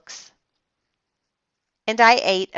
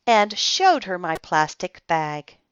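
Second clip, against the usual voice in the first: usual speaking voice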